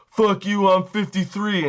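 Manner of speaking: high, loud mock protest; indignant customer. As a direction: snap back as an offended customer. Acted customer refusing ID check.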